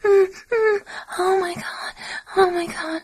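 high loud cries of pleasure; loud moaning. Real, not acted. Climax moaning after turning it up.

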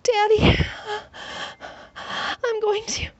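high, loud, drawn-out pleading. A narrator's reading. She begs Daddy on the edge, high and loud. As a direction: climb up high and loud, begging. On the brink of climax.

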